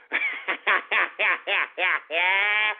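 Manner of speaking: long staccato cackle; drawn-out gloating laugh; mocking cackle. Real, not acted. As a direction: laugh derisively in rapid even bursts. Laughs at listeners he just insulted.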